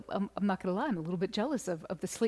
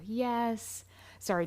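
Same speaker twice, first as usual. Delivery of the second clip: usual speaking voice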